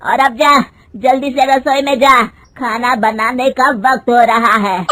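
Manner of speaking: loud, curt command to get to the kitchen; angry order. Acted. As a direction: shout her off to the kitchen. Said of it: "उसकी सास गुस्से में कहती है".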